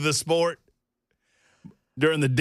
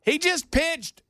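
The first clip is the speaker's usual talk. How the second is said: high, slow, emphatic amazement; incredulous raving; 8 semitones higher, slower than usual. Real, not acted. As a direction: marvel at each feat, pitched high and drawn out. Amazed rant about a player's feat.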